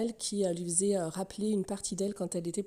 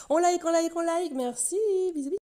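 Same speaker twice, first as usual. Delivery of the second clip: high-pitched singsong sign-off; mock sign-off; 8 semitones higher, 4 dB louder than usual. Host pretends to wrap up for viewers, high mock sign-off. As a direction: chirp it high and louder at the start.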